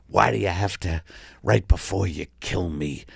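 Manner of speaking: usual speaking voice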